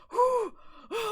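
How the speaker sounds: high, whooshing exhale; sharp sigh. Real, not acted. Streamer pausing mid-sentence to regroup, loud sigh. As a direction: blow out a loud breath.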